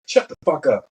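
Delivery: high-pitched mock tirade; digging into someone. Senior chief silencing a young sailor, retold tirade. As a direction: imitate a superior laying down the law. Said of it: "started fucking digging into me".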